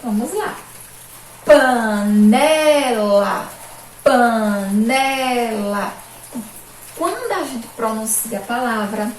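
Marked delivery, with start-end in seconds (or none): 1.4-5.9 "Panela" stretched very slowly and loudly, syllable by syllable; loud, slow-motion "panela" so the stressed NE rings out; drawn-out pronunciation demo. Shown as a demonstration. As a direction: sound out "panela" with long vowels, leaning on NE. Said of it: "ouvir a pronúncia da palavra panela".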